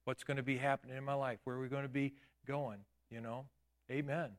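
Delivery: ordinary talk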